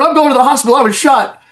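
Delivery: high retorting snap; mock angry boss. Boss snapping back at teasing officer, high retort. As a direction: fire it back, half laughing.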